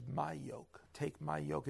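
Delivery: preacher quietly repeating the verse for the congregation. Acted. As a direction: let take my yoke settle gently. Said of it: "When he says".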